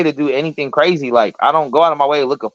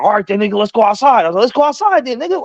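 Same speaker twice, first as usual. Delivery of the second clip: higher-pitched acted bravado; heated mock dare, pitch climbing; retold challenge; 6 semitones higher than usual. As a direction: re-enact squaring up for a fight. Brothers challenge each other, retold.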